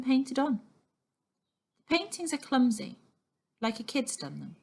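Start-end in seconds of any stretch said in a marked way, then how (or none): none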